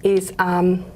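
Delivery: usual speaking voice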